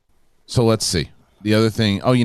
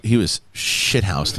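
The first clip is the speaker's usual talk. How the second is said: ordinary talk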